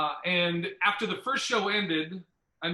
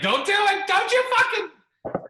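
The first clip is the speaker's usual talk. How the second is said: mock shrill warning in a high voice; mock threat; 13 semitones higher, 7 dB louder than usual. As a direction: squeal it like a fake threat.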